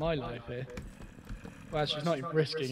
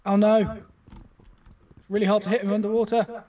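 panicking gamer; 5 semitones higher, 7 dB louder, slower than usual. Real, not acted. Fighting skeleton underwater.

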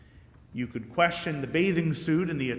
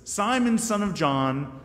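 Simon called out high, then louder and slow; insistent Jesus; 4 semitones higher, 7 dB louder, slower than usual. Acted. Jesus pressing Peter a third time, louder and slower.

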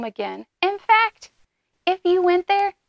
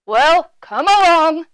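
big goat voice; 4 semitones higher, 8 dB louder than usual. A narrator's reading. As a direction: dare the troll as the big goat. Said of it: "in his big voice".

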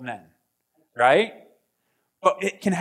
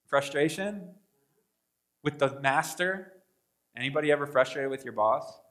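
ordinary talk, nothing marked

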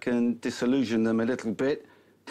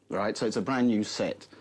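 ordinary talk

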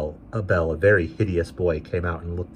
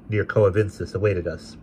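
ordinary delivery

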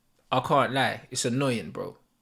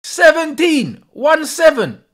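loud, higher, slow outraged disbelief; can't believe the number, shouting it out; shocked disbelief; 9 semitones higher, 8 dB louder, slower than usual. Real, not acted. Disbelief at stat.

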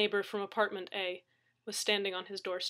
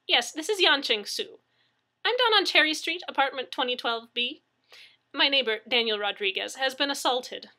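high, raised phone voice; brisk worried neighbor; 5 semitones higher, 5 dB louder than usual. A narrator's reading. Neighbor reporting an assault to dispatcher, urgent.

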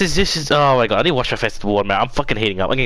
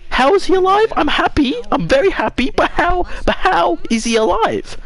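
very high, squealing astonishment; real high-pitched amazement; amazed disbelief. Real, not acted. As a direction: blurt it high in happy bewilderment. Amazed that a character is alive.

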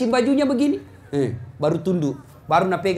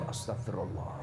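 murmured istighfar; 12 semitones lower, 14 dB quieter than usual. Shown as a demonstration. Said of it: "Kau lihat cewek cantik".

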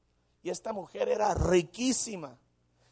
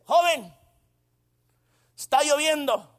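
high, loud granny call; complaining old lady; 7 semitones higher, 7 dB louder than usual. Retold or imitated. Old lady in the rain hails young driver, high complaint.

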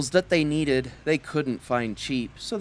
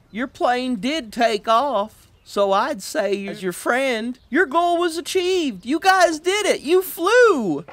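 encouraging Len; 8 semitones higher, 3 dB louder than usual. Acted. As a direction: build up to a proud cheer. Len cheers them up.

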